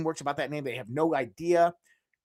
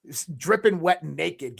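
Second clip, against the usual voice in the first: ordinary talk, nothing marked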